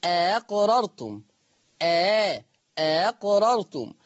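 أقررتم very slow, second hamza softened; eased hamza. Shown as a demonstration. Teacher demonstrates tas'heel to students. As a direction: glide through the hamza in أقررتم. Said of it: "يقرأ الهمزة التانية بالتسهيل".

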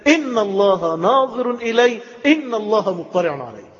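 high, slow, emphatic proclamation; drawn-out call from the heart; ringing inner call. Acted.

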